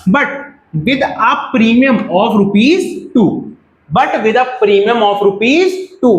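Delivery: every word of the premium said very slowly; slow dictation. Shown as a demonstration. Teacher reading the question to students, very slow and deliberate. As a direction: spell the question out word by word for the class.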